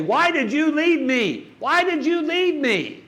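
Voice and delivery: high, slow, pleading cry of grief; a widow pounding the shower wall, crying out in grief; anguished cry to a lost husband. Retold or imitated. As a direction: cry out why, stretched and desperate. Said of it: "so frustrated and so angry".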